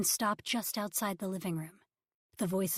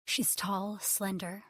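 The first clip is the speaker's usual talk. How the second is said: usual speaking voice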